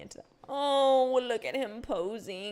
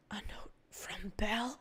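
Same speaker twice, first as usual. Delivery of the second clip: slow whisper, softer; 6 dB quieter, slower than usual. Acted. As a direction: whisper it like a secret note. Reads game note aloud.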